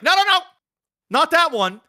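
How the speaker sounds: high-pitched mock refusal; imitating fussy kid. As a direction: play a picky kid waving off the wrong one. Acting out kid rejecting the yogurt.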